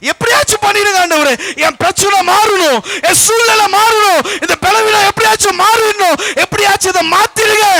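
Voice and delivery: high, loud cry of prayer; desperate pleading. Acted. Believers begging God to change their situation, crying out. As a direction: beg urgently at full voice. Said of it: "நாம் அநேக நேரம் அப்படித்தானே கேட்டுட்டு".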